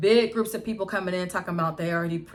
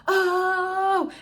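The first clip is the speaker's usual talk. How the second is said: much higher, loud yell of alarm; loud, high crowd cry; 13 semitones higher, 7 dB louder than usual. Retold or imitated. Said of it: "start yelling and screaming".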